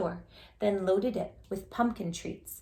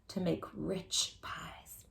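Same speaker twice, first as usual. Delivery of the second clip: slow hushed whisper; savoring whisper; 3 semitones lower, 8 dB quieter, much slower than usual. A narrator's reading. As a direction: breathe it out slowly, secretive. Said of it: "whispered recipe ending".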